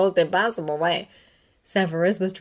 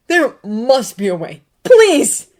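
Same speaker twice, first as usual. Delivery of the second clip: much higher and louder, the please cried out; desperate crying plea; 7 semitones higher, 12 dB louder, slower than usual. A narrator's reading. Severus kneeling before Dumbledore, crying plea. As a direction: beg through tears. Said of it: "tears ran down his face".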